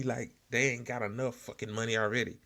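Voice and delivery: ordinary talk, nothing marked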